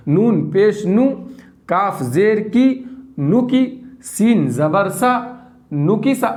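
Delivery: slow, clear syllable-by-syllable spelling; slow spelling drill. Shown as a demonstration. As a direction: spell each letter out slowly for pupils. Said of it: "teacher spells syllables slowly".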